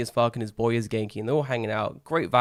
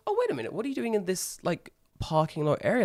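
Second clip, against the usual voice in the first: surprised; 7 semitones higher than usual. Retold or imitated. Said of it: "And he goes".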